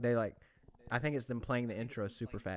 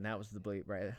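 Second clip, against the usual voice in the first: ordinary delivery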